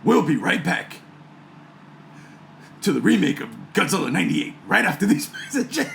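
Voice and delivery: lightly voiced trailer announcer, breaking into a laugh; a hint of trailer voice; movie voice. Shown as a demonstration. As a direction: announce the break, cracking up near the end. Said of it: "got another one".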